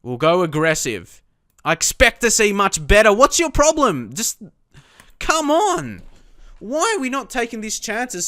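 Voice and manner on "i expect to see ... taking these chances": raised, much higher and louder; frustrated outburst. Real, not acted. Player venting at his team's wasted chances, frustrated and loud. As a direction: let the frustration boil over. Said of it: "Sorry for getting frustrated".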